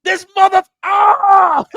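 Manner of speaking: high, loud mock scream; hammed-up howl of fury; enraged killer's outburst. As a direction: break off cursing into a yell. Acting enraged killer reacting to police.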